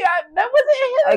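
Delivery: high, loud, drawn-out objection; squealed protest. Real, not acted. Co-host insisting it was them talking, squealed protest. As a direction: wail it in protest.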